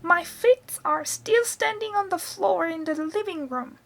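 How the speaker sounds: put-on high, cackly old grandma calling down; high-pitched old woman showing off; Grandma's voice. A narrator's reading. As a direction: crow it from the rooftop as Grandma. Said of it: "cackled the old woman".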